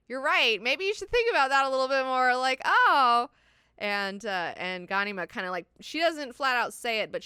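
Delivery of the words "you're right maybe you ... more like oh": loud, high mock epiphany; sudden realisation. Ghanima reacts to Irulan's slip, sudden realisation. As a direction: burst out as the penny drops.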